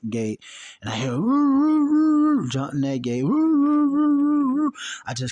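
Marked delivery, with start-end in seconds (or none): 1.1-2.5 high, loud howling woo woo like a barking dog; dog barking imitation. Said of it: "I hear all these dogs barking". 3.2-4.7 high, loud howling woo woo like a barking dog; dog barking imitation. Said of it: "I hear all these dogs barking".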